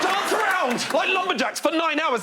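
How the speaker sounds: high, drawn-out shouted command; manic parent. Acted. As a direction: order the kids about with crazed enthusiasm. Acted manic parent ordering kids.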